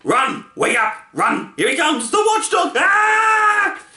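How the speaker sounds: terrified Lethargarians shouting as the watchdog charges; panicked shouting. A narrator's reading. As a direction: scream a warning and run.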